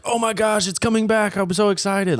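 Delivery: high, gushing fan voice; excited fans. Retold or imitated. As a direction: squeal it like a thrilled fan. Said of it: "were like".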